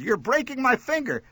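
high, pained complaint; pained yell. A narrator's reading. Mother with trapped finger to son, yelling. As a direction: yell that it hurts. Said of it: "she yelled".

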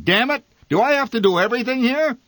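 high, loud and fast complaint; fed-up martyr ranting; angry father. A narrator's reading.

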